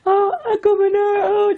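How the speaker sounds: very high imitation wail; imitated child crying. Aunt imitating the three-year-old niece seeing her beaten uncle, crying. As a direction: cry out like a tearful little niece. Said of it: "and she was crying".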